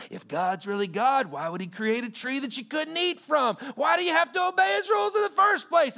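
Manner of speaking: high, loud taunting voice of the enemy; loud mocking voice of the enemy; mock tempter voice. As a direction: sneer the tempter's question.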